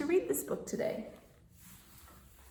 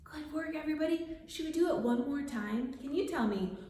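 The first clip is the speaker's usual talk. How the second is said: plain reading voice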